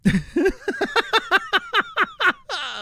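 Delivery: long squealing fit of laughter; loud high cackling laugh. Real, not acted. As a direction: let the laugh run out of control.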